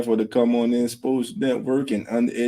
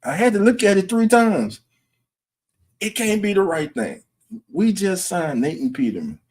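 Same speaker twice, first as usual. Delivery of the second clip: much higher, stunned can't-believe-it delivery; shocked disbelief; 11 semitones higher than usual. Shown as a demonstration. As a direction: act out the shock of seeing the news alert. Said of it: "This was my reaction".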